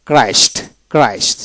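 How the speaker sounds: ক্রাইস্ট with a plain K sound at the start, no H; silent H. Shown as a demonstration. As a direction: say ক্রাইস্ট clearly, H left silent. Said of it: "এখানে এইচ এবং ই বাদ যাবে".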